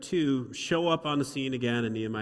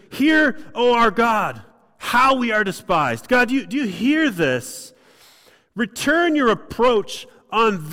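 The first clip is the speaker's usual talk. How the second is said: much higher, much louder pleading fury; brutal prayer; 8 semitones higher, 7 dB louder than usual. Acted. Nehemiah praying against his enemies, loud furious plea. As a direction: cry out to God for vengeance. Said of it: "a brutal, honest prayer".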